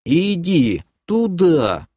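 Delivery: slow, high, stretched-out order; drawled instruction. Acted. Elf sends the demoness away, slow drawl. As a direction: draw out each word.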